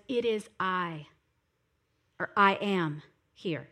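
grave, unhurried statement of identity; solemn declaration. Acted. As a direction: draw the words out low and weighty. Solemn rendering of Jesus's declaration.

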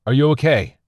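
high, loud, anxious call. A narrator's reading. Survivor calls to his missing friend, calling out. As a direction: call out to check on him. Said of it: "called out again".

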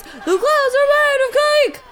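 high, frenzied crowd chant; high-pitched gleeful holler; can't calm down. Acted. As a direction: cry it out, too excited to think. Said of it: "who couldn't calm down".

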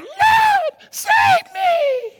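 panicked high scream for rescue; desperate cry. Acted. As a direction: yell it as if you're sinking. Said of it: "cried out".

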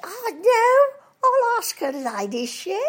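high and loud silly toff; Mister Twit. Retold or imitated. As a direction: yelp it, then settle. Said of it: "And he says".

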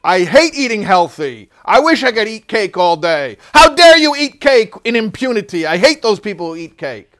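loud, high whiny complaint; jealous cake lover. Acted. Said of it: "But then the guy who says".

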